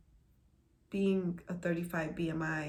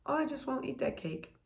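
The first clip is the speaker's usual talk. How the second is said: lightly acted higher-pitched self-talk; mock resolve; 7 semitones higher than usual. To herself about skipping food, mock resolve. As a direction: imitate brushing it off in a raised voice.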